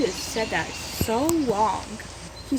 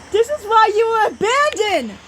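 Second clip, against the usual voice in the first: loud, high venomous hiss; loud hurtful hiss; cruel hiss; 12 semitones higher, 8 dB louder than usual. A narrator's reading. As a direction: hit where it hurts, hissing. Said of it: "I hissed".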